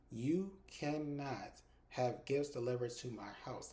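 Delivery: slow, deliberate telling-off; measured, emphatic reprimand; firm scolding. Retold or imitated. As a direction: lay it down word by word. Woman firmly setting caller straight.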